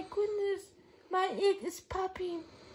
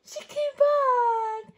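drawn-out, loud, happy repeat; slow and warm; 7 semitones higher, 8 dB louder, much slower than usual. Acted. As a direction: savor it slowly for the child.

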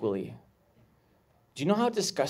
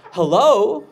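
loud, high hello; acted-out phone greeting; 7 semitones higher, 11 dB louder than usual. Hoarder woman answering the phone, loud high greeting.

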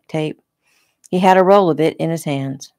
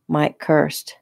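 usual speaking voice

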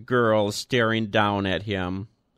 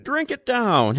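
hearty urging; 13 semitones higher, 4 dB louder than usual. A narrator's reading. Boss urges the old man.

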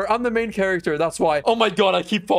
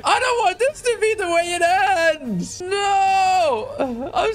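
fearful wail; 10 semitones higher, slower than usual. Real, not acted. Dreading death in climb game.

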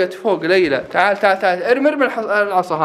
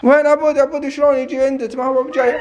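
calling out; 8 semitones higher than usual. Pretend. Searching haunted house calling.

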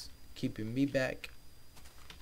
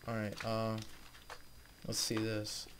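normal talking voice

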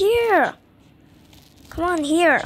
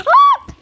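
sharp, very high gasp then yelp; startled gasp scream; 13 dB louder than usual. Real, not acted. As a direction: scream at the jump scare. Jump scare in game.

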